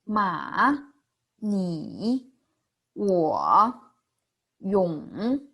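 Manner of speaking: 马, 你, 我, 勇 each dipping then rising; third tone. Shown as a demonstration. Teacher practices the third tone, exaggerated dip and rise. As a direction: stretch each word down and back up. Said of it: "Let's practice the third tone".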